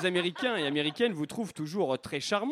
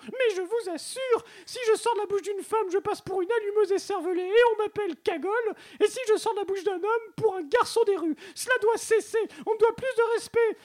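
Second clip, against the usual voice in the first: put-on very high-pitched, indignant Marseille accent; Marseillais accent; 14 semitones higher, 3 dB louder than usual. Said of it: "je reçois l'accent marseillais". (accent marseillais haut perché, indigné)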